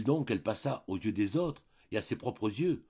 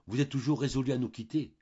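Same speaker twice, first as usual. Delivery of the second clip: higher, louder, voice trembling with emotion; 6 semitones higher, 4 dB louder than usual. A narrator's reading. Shop owner begging Denise to stay, trembling voice. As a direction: let the voice quiver as he asks. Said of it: "dont la voix tremblait".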